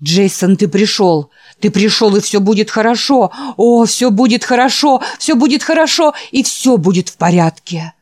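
loud, high tearful joy; ecstatic old man. Acted. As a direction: cry out in rapture. Old man rejoices at Jason. (восторженный крик старика)

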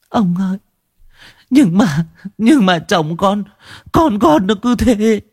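high, feeble lament of a grieving mother; high, faint grieving woman's voice. A narrator's reading. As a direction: plead weakly to the old man in grief. Mother answers again.

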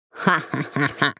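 low, slow mocking laugh; lizard laughter. A narrator's reading. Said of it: "рассмеялись ящерицы".

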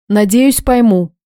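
plain reading voice

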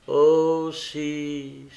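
tortured exclamation, loud then fading; anguished cry. A narrator's reading. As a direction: cry out in torment of conscience. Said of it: "s'écria la jeune fille en proie aux tortures de sa conscience".

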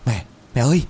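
alarmed call. Acted. Finding mother lying still.

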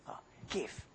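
soft unvoiced whisper; breathy rhetorical whisper; whispered question. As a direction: drop to a whisper as if confiding the question. Rhetorical question dropped low.

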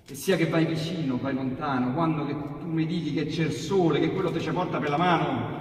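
ordinary delivery, nothing marked

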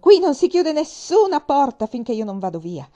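loud, high, furious command; angry shout. Acted. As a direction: keep shouting at the soldiers. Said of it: "gridai io". (ordine urlato, forte e acuto)

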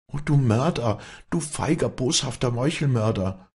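high, anguished outcry; sobbing accusation. A narrator's reading. Walli accuses Vinzenz of murder, sobbing out high. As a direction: cry out the accusation in high, mild sobbing distress. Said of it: "schluchzte Walli auf".

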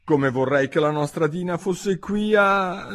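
high, slow wishful call; high, drawn-out longing. A narrator's reading. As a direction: wish aloud, stretching the last word. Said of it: "disse Alice alzando la voce".

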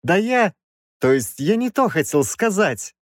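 high, faltering retraction; frightened backtracking. A narrator's reading. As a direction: backpedal nervously with a hitch. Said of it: "испугался он".

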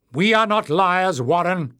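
high, loud protest; shouting. A narrator's reading. Jasmine desperate to undo Glock's damage, high shout. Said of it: "shouted Jasmine".

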